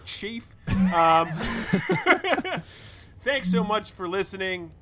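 usual speaking voice

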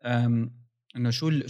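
usual speaking voice

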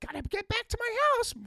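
very high, loud bird squawk; mocking Tony as a parakeet. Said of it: "Tony's like a parakeet".

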